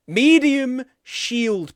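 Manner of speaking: high, slow goofy voice; silly drawn-out voice. Pretend. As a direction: stretch it out like a joke chant. Repeating the phrase playfully.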